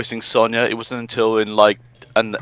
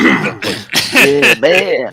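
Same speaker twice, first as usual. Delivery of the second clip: stoner voice; 3 semitones higher than usual. Retold or imitated. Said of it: "Manic sounding like the, uh, stoner".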